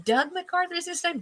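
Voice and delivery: high puzzled second try at the name; high doubtful question. Real, not acted. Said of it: "Douglas, isn't it?".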